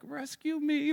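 silently begging to be rescued; pleading. Retold or imitated. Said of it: "I'm looking over at Beth like".

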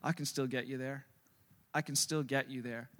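a hint of a calm GPS. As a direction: quietly reassure like a sat-nav. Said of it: "what does G P S do?".

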